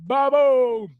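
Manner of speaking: loud, slow, drawn-out welcome call; excited shout-out. Real, not acted. Streamer hyping a viewer arriving in chat, loud slow welcome. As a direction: belt out the name like an announcer.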